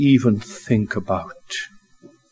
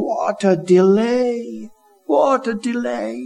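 rising fervour; 8 semitones higher than usual. Acted. Preacher rising over Lazarus's death, climbing lament. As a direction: pitch climbs higher and higher, slowing.

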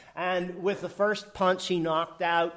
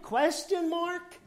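high, slow, drawn-out question mark; pointed rhetorical aside; emphatic aside; 13 semitones higher, slower than usual. Acted. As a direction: lean on it like a punchline.

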